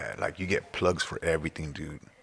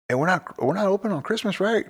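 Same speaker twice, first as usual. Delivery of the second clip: much higher, pleading question; higher hopeful voice asking the boss; mock hopeful question; 11 semitones higher than usual. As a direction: ask hoping for a day off. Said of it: "I'll be like".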